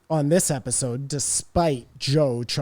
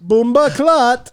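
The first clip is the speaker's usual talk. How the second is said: slow, loud Jamaican slang; hammed-up Jamaican accent; mock Jamaican accent; 9 semitones higher, 8 dB louder, much slower than usual.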